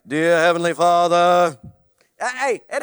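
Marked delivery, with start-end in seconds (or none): 0.1-1.6 hammed-up holy tone on Father; mock reverent prayer; sudden prayer voice. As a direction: switch into a loud, booming mock prayer voice. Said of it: "the voice changes".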